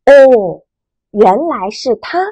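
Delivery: 哦 level and calm, 认识 stated plainly; flat statement tone. Shown as a demonstration. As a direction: say 哦 evenly, just stating. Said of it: "声音是平直的". (哦，平直陈述的语气)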